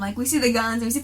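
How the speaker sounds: ordinary talk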